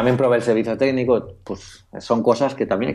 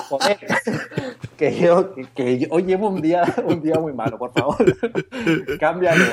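high, laughing mock plea to change it; 7 semitones higher than usual. Customer laughingly begs the shop clerk to swap it. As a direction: laugh through a pleading request.

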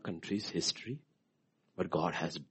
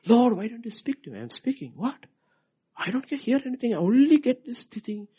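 1 complaining believer; 8 dB louder than usual. Acted. Dialogue with God acted.

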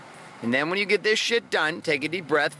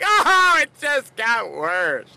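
mock whine; 7 semitones higher, 6 dB louder, much slower than usual. Rejected husband moaning.

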